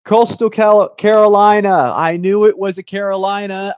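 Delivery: loud, high burst of recognition; excited. Real, not acted. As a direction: blurt it out as it clicks. Answer revealed, sudden recognition.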